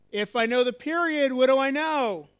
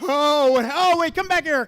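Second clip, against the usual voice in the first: raised voice, calling the students back; loud calling back; 4 semitones higher, 7 dB louder than usual. Real, not acted. Teacher calling wandering students back, loud. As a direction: call them back across the room, loud. Students walk off during demo.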